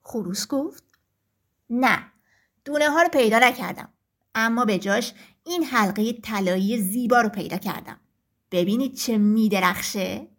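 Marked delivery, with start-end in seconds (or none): 1.7-10.3 proud rooster. Acted. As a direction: show off the shiny ring. Rooster shows the ring.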